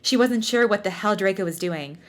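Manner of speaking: ordinary talk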